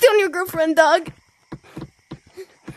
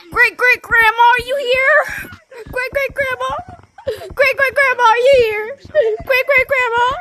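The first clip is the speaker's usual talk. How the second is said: high, raised searching call; playful repeated hollering, pitched up; 5 semitones higher than usual. Pretend. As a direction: call out for a missing grandma. Calling for grandma in the game.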